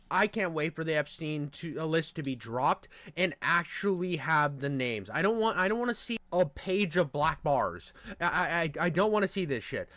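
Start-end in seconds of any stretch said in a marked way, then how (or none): none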